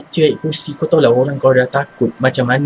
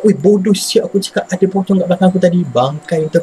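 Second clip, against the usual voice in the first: retold panicked cursing; 7 semitones higher than usual.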